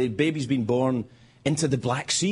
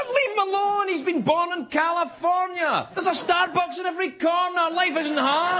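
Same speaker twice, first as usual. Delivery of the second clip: protective shout. Retold or imitated. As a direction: defend the newborn at full voice. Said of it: "I said".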